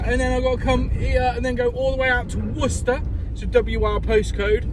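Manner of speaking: usual speaking voice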